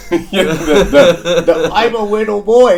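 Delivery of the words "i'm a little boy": put-on high, louder childish whine; whiny little boy voice. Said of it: "I'm all in on that voice".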